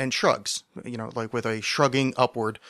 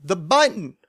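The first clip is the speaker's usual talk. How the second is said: louder, higher call; 5 semitones higher, 5 dB louder than usual. Retold or imitated. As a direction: call it across the street. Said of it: "my lady goes".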